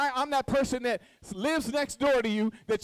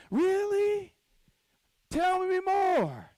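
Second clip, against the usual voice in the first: much higher, drawn-out fake interest; mock eager listener; 8 semitones higher, much slower than usual. Friend hearing gossip, mock eager and much higher. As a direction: play the nosy friend hungry for gossip. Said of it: "and you like".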